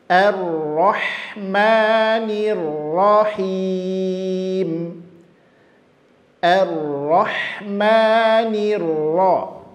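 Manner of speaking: slow, sustained Quran recitation; tilawah tartil. Shown as a demonstration. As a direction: recite with long held vowels and rolled r. (bacaan tartil, pelan dan panjang)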